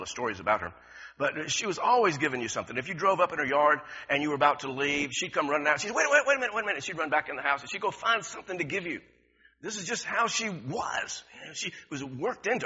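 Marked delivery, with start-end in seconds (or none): 5.9-6.8 high, eager call to stop them leaving; mother hurrying guests to wait; a hint of a mother calling out.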